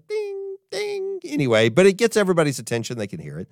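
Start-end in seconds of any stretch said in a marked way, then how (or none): 0.1-1.2 high, soft little bell sound; a tiny tinny ding ding; mock bicycle bell; imitated bell. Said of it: "doesn't sound like it".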